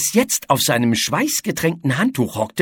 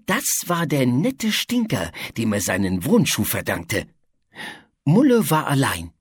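usual speaking voice

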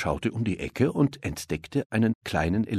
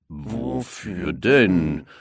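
confused lion; 3 semitones lower, 3 dB louder, much slower than usual. A narrator's reading. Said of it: "fragten Löwe und Tiger verwirrt".

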